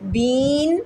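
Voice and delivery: बीन with a long, dragged ee; overstretched BEEN. Shown as a demonstration. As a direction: wrongly stretch the vowel in बीन. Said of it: "ना बन जाए". (बीन की ई खींचकर ग़लत पढ़ना)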